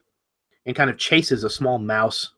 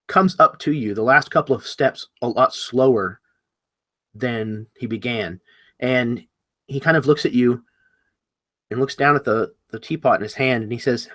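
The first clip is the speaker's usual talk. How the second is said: ordinary talk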